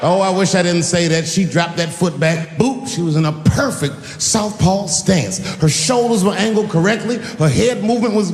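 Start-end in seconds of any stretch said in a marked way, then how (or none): none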